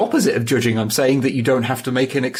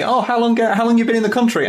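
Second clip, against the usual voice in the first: higher, quicker imitation of a nosy questioner; imitated stranger asking, pitched up; curious stranger; 11 semitones higher, faster than usual. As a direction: put on a chirpy small-talk questioner. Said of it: "someone would ask me".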